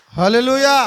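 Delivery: loud call of hallelujah. Real, not acted. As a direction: shout it out together. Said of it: "నాకు విన రావడం లేదు". (గట్టిగా హల్లెలూయా)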